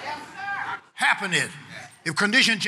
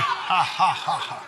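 spaced-out preacher's laugh; deliberate laugh; 2 semitones lower, slower than usual. Shown as a demonstration. Preacher leading the congregation in holy laughter, deliberate laugh. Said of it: "Somebody help me laugh".

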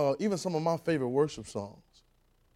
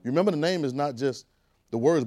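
usual speaking voice

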